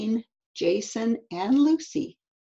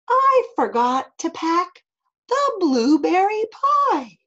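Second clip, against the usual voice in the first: animated mom; 6 semitones higher, 6 dB louder, slower than usual. Acted. Mom lists food, then gasps.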